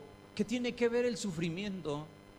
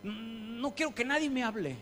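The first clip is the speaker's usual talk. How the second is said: a mildly raised, petulant no; mock sulky refusal; sulking relative; 5 semitones higher than usual. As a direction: play the stubborn grieving relative lightly. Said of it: "les dicen".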